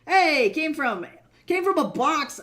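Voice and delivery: big hollered hello; loud excited greeting. Real, not acted. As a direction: call out to a newcomer, high and loud. Calls out greeting to arriving viewer.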